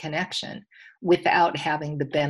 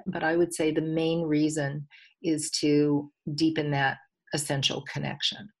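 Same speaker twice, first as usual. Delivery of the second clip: ordinary talk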